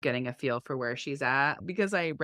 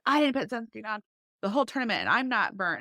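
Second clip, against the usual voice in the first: put-on defiant teen son; 6 semitones higher, faster than usual. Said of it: "he was like".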